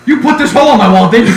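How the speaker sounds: loud, fast mock accusation; accusing teacher. Teacher accusing the student, loud mock anger. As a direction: fire it off like an angry teacher. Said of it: "He's like".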